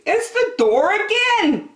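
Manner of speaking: high, kindly park keeper; a gentle, fussy park keeper; Percy's voice. A narrator's reading. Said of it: "said Percy".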